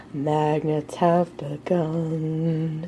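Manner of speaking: slow, low, soft movie-trailer voice; dramatic deep announcer. Shown as a demonstration. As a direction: intone it like an ominous trailer. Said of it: "Instead of".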